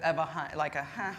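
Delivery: ordinary delivery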